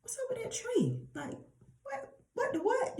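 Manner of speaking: high-pitched puzzled mock reaction; baffled disbelief. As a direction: squeak it out in comic bewilderment. Acting Moses puzzled at the bush.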